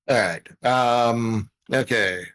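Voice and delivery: normal talking voice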